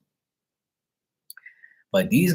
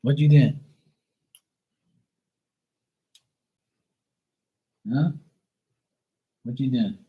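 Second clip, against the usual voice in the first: talking to the dog. Real, not acted.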